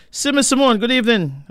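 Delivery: high and loud call-out; loud greeting. Real, not acted. Radio host calling out a listener's name in greeting. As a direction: hail the listener warmly across the room.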